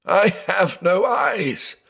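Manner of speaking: high, slow, wailing lament; sobbing cry. Acted. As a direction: wail it out in despair.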